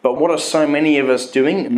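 ordinary talk, nothing marked

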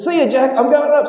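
high, chirpy farewell; cheerful see ya. Acted. Bubba leaving Jack behind, high and chirpy. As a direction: toss it off gleefully.

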